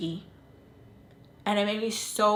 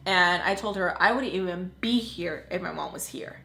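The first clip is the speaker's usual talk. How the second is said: usual speaking voice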